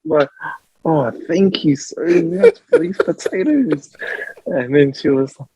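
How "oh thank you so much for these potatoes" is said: high, slow, gushing mock gratitude; over-the-top thanks. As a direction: lay the thanks on thick, slowly. Retelling own overjoyed thanks.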